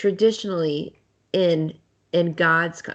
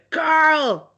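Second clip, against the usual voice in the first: loud, high Southern shout of Carl; fake Southern accent; 10 semitones higher, 6 dB louder than usual. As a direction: yell Carl in a hammed-up drawl. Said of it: "walk around the house just going".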